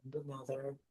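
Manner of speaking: calm, unhurried guide with long pauses between phrases; guided meditation. Acted. Jedi teacher guides students lying on the floor through breathing, calm meditation voice. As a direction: ease them through each breath, lifting gently on the in-breath.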